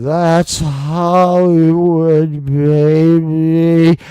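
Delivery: very slow, drawn-out low croon; showbiz voice. Pretend. Host tossing off a catchphrase, hammed-up showbiz voice. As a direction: stretch it out like a showbiz big shot. Stock phrase in put-on showbiz voice.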